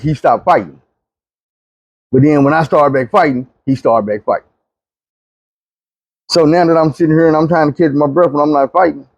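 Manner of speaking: ordinary talk, nothing marked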